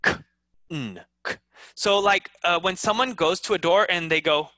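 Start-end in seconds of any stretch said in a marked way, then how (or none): 0.0-1.4 "k" as a bare K click, then "n"; wrong, sounded K. Shown as a demonstration. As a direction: sound out "k" before the "n". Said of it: "do not say".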